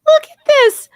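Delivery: words through a high laugh; can barely talk for laughing; laughing hard. Real, not acted. Cracking up at the clip.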